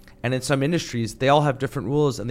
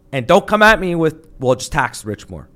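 fed-up outburst; 6 semitones higher, 8 dB louder than usual. Real, not acted. Guest snaps at imagined critics.

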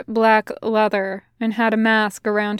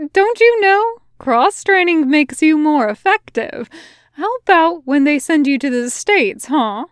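higher, louder teasing soldier voice; 6 semitones higher, 5 dB louder than usual. A narrator's reading. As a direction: tease him, pitched up.

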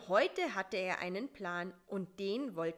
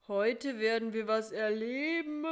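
drowsy, grumbling repeat in a different spider voice, louder; half-awake spider mumbling the words back; groggy, put-upon repetition; sleepy echo; 3 semitones higher, 6 dB louder than usual. A narrator's reading. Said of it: "kam es verschlafen von Karl-Heinz".

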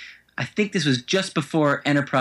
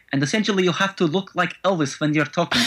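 thick comic Russian accent, pitched higher; Chekhov's Russian accent; 4 semitones higher than usual. Shown as a demonstration. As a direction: put on a Star Trek ensign's Russian accent. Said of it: "The key to doing the Chekhov voice".

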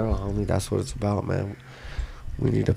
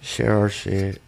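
stoned; 3 semitones lower, 8 dB quieter than usual. Real, not acted. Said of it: "I'm just a little stoned".

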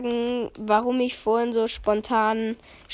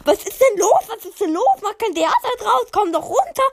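higher, louder mock mother calling up; imitating mom; 8 semitones higher, 5 dB louder than usual. Son imitating his mother calling up the stairs, high and loud. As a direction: call up the stairs like a fussy mother. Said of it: "ruft meine Mutter".

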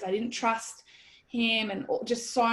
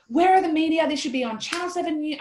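higher, louder frantic outburst; mad, frenetic; 6 semitones higher, 5 dB louder than usual. Retold or imitated. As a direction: burst out, outraged and frantic. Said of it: "I was, like, mad, like, frenetic".

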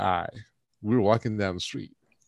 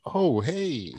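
high, softened mock greeting; sudden sheepish friendliness; 6 semitones higher than usual. Re-enacting recognizing the celebrity who shoved them, suddenly meek and friendly. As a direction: back off into a sweet little hello.

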